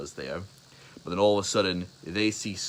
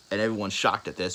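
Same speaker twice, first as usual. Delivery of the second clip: ordinary delivery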